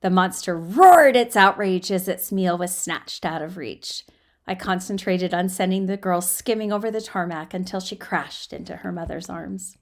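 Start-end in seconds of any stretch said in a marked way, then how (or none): none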